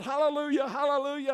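high, quick mock shout of praise; retold shout. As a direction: cry hallelujah like an excited pastor. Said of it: "he began to shout".

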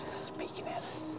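hushed whisper. Real, not acted. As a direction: whisper it under your breath. Sneaking through haunted house.

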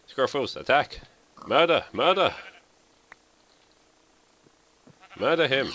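high, loud frenzied battle order; shouted command. Real, not acted. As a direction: scream it at the dog. Commanding dog to attack.

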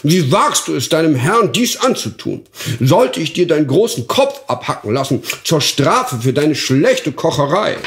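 angry duke. Acted. Duke threatens to behead his cook, furious. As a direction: bellow at the dwarf.

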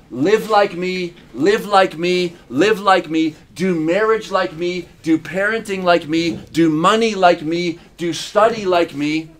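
slow, each "like me" pressed home; slow insistent repetition. Acted. Pastor urging his flock to copy his life, slow and emphatic. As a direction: proclaim it as if through a loudspeaker.

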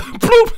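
high playful bloop for a phone hanging up; hang-up sound. Retold or imitated. Preacher mimicking the phone call ending, comic bloop. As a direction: squeak out a cartoon bloop. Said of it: "laughs Bloop".

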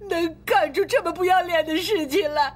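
crying accusation. Acted. Wife berating her unfaithful husband, crying bitterly. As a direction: sob out the accusations. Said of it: "别哭了".

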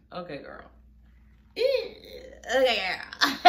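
real laughter through the words; laughing. Real, not acted. Host laughs at the twins' reply, laughing.

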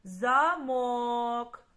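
замок called out as za-MOOOK, last syllable long; замок hailed with a long, stressed second syllable. Shown as a demonstration. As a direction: stretch the МОК as you call замок. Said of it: "Давайте ще раз погукаємо".

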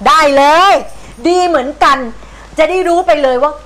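fine then, spat out in fury; grandma threatening the stubborn cat; sarcastic anger. Acted.

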